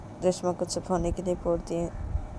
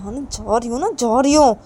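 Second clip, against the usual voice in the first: raised, louder irritated retort; exasperated; 5 semitones higher, 11 dB louder than usual. Acted. Kajal tells Reshma she is going, exasperated. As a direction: give in crossly, getting louder.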